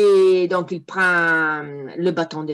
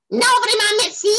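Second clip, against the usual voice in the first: much higher, louder shrill refusal; second devil; 4 dB louder than usual. Acted. Second devil refuses the visit, shrill and high. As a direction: squeak no thanks like a prissy imp. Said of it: "dit la seconde".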